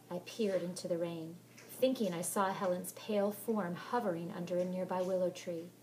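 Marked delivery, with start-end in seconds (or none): none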